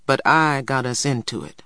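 ordinary delivery

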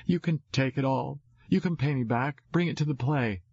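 lightly voiced kid urging her brother; generous little sister. A narrator's reading. Voices young sister in story.

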